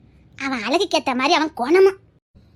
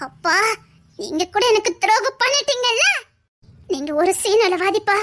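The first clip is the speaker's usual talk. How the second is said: higher voice, crying through the words; tearful reproach; 5 semitones higher than usual. Acted. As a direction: sob out the hurt at father. Daughter reproaches absent father, crying.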